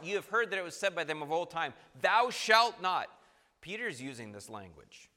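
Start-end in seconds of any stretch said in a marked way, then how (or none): 2.0-3.1 THOU SHALT NOT loud, higher and slow; emphatic commandment. Retold or imitated. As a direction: hammer out each word of "Thou shalt not". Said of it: "Very emphatic, emphatic words".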